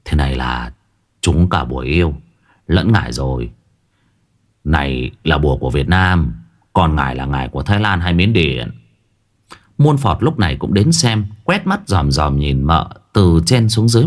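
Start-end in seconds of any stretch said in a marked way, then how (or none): 0.0-8.7 low, measured verdict; grave old shaman. A narrator's reading. Shaman diagnoses the spell to everyone, low and grave. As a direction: pronounce it deep like a wise shaman.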